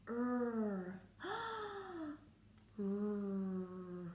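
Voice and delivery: ordinary talk, nothing marked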